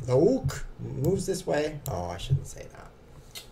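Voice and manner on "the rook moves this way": slow put-on French accent, rook without the r; mock French accent, The ook moves this way. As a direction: imitate the French streamer saying ook slowly. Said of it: "who's like".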